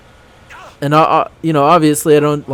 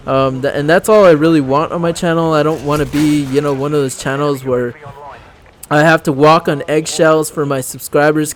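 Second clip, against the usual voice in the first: normal talking voice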